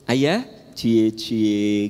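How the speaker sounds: lightly voiced teasing wife; a hint of a jealous wife's tease. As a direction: coo it at the dressed-up husband. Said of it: "Istri Bapak teh bilang gini".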